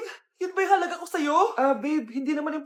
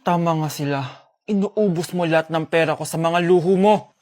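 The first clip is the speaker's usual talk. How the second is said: stern father; 11 semitones lower, 6 dB louder than usual. Acted. Father scolds the spendthrift wife.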